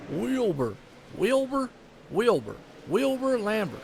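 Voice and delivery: playing up his own Southern accent on the name "Wilbur". Shown as a demonstration. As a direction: say "Wilbur Lambert" the Southern way, leaning into the twang. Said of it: "y'all probably gonna get a kick out of it".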